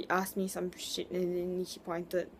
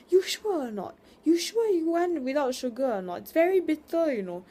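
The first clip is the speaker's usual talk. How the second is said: much higher, louder nagging mom; imitating insistent mom; 9 semitones higher, 4 dB louder than usual. Mom pushing sugar on the aunt, high, loud and insistent. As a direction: badger her like an insistent Singaporean mother. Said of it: "my mom was like".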